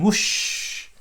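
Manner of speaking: breathy whoosh of a balloon; whoosh sound. Pretend. Dad to child, a whooshing balloon noise. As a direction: blow it out like wind. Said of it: "Hot air balloon".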